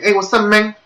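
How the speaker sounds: put-on high Cuban accent greeting; Cuban-accented casual hello. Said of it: "he did get it right".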